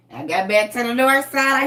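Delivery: usual speaking voice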